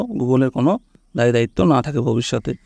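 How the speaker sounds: ordinary talk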